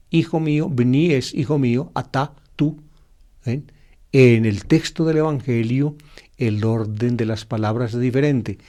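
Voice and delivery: normal talking voice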